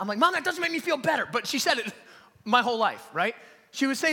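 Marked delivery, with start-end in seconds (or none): 0.2-1.2 put-on high-pitched exasperated kid; mock childish complaint. Scared boy protesting mom's verse, high mock complaint.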